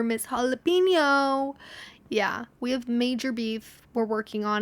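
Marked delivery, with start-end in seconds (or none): 0.0-1.6 mock title. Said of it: "gonna start calling her".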